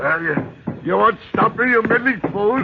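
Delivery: high, loud raging defiance; furious shout. Acted. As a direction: scream him off in fury. Geoffrey defies the constable.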